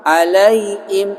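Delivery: slow "Alaihim" without its h, alai-im; h turned hamzah. Shown as a demonstration. Teacher repeating the misreading, h dropped. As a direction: drop the h in "Alaihim". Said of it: "Itu kan jadi hamzah".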